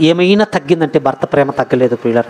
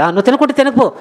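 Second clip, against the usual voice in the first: higher-pitched mock brush-off; dismissive husband; 7 semitones higher than usual. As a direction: imitate shrugging off a sulking wife. Uncaring husband acted for contrast. (పట్టించుకోని భర్త అనుకరణ)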